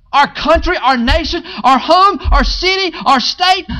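high, loud preacher's climax; preaching. Acted. Pastor rallying the church about flourishing, fervent preaching. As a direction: build it up and lift the room.

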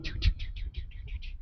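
soft unvoiced choo sounds; whispered paddling cricket sound; swimming cricket noise. Cricket kicking away from the fish, soft unvoiced choo.

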